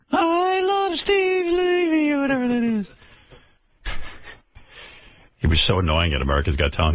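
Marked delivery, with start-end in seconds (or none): 0.1-2.9 squeaky falsetto, very high and slow; mock the guy with a shrill falsetto; High Pitch Eric impression. Radio host mimicking High Pitch Eric, very high squeak. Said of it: "High Pitch".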